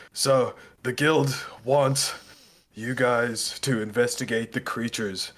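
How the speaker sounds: slow, halting read-out; nervous reading aloud. Acted. Guild man reads orders with shaking hands, slow and nervous. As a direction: read it out word by careful word. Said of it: "his hands are shaking as he's reading this paper".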